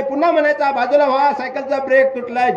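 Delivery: high, shrill mocking of his shouts; high scolding voice mimicking the boy's yell; indignant woman mocking. Woman complains in court. (कोर्टात बाईचा उंच, चिडलेला, चिडवणारा आवाज)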